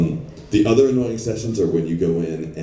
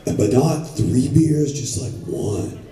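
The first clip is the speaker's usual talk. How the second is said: a higher, lazy surfer-dude director voice; mock laid-back ad creative; 4 semitones higher than usual. Ad agency creative coaching the voice actor, a lazy higher bro voice. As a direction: play the chill beach-bro creative. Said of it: "douchey dudes ... board shorts".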